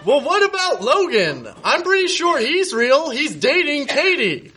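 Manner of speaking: hammed-up huffy comeback; sassy retort. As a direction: snap back like a jilted diva. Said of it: "Nate retorts in fan fiction".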